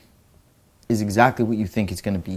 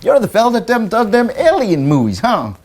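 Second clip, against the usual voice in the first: folksy alien believer; 10 semitones higher, 3 dB louder than usual. Retold or imitated. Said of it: "a guy that, like, believes in aliens".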